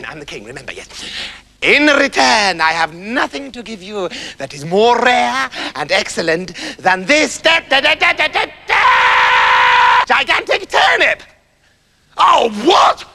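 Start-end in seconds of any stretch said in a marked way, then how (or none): 1.6-11.2 builds to a shouted turnip; king drags out da da then shouts; rising shout. Acted. The king builds to a triumphant shout. 12.2-13.0 outraged. Acted. Septimus outraged at gift.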